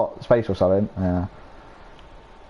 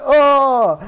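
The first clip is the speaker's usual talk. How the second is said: high, loud, shocked yelp; disgusted shriek; 6 dB louder than usual. Retold or imitated. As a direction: yelp in horror. Retold disgusted reaction.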